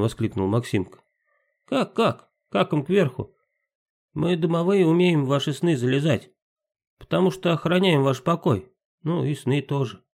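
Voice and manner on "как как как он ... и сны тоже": pixie. Acted. Timka explains dreams.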